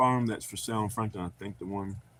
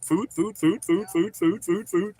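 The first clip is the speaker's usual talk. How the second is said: higher pitched chant of a greedy dog; begging dog voice; 6 semitones higher, 4 dB louder, slower than usual. Retold or imitated. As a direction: chant it like a hungry pup begging.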